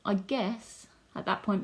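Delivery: ordinary talk, nothing marked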